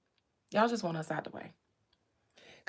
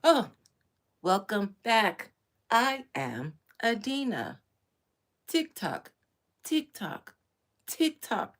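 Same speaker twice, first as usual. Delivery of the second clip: slow robotic intro, then stuck repeats; bright glitchy machine voice; glitching influencer robot; 4 dB louder, slower than usual. Retold or imitated. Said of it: "it keeps glitching".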